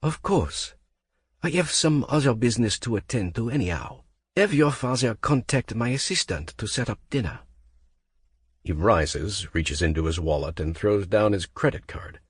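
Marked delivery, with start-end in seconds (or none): 0.0-7.4 put-on clipped-polite Frenchman in a French accent; a French accent hiding anger under courtesy; cool French accent. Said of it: "his smooth accent".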